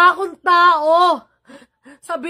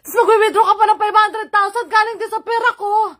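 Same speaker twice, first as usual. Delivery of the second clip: high, loud, bitter half-laugh; ranting in disbelieving fury; angry shout; 6 semitones higher, 3 dB louder than usual. Real, not acted. Furious rant at sister.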